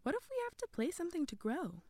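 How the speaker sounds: high, tentative question; Sophia wondering aloud. A narrator's reading. Girl speculating on how to grow, wondering aloud. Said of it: "Sophia wondered aloud".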